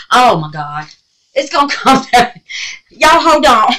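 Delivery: loud, high panicked laughter; half laugh, half scream at the bug; panicking. Real, not acted. Warning viewers as the bug falls, loud panic.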